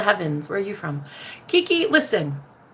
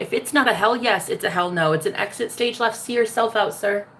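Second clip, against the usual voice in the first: normal talking voice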